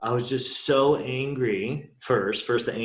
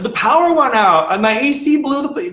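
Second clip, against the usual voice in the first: exasperated listing; 13 semitones higher, 9 dB louder than usual. Real, not acted. Rattling off a string of mishaps.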